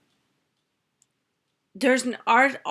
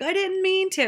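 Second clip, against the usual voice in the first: high-pitched kid's protest, imitated; upset child; 10 semitones higher than usual. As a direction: mimic a distressed daughter pleading it was an accident. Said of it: "She was so upset".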